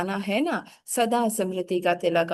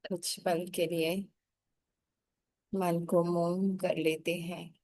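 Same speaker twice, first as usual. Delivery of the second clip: guided meditation; 5 dB quieter than usual. Acted. (धीमी, कोमल ध्यान करवाने वाली आवाज़)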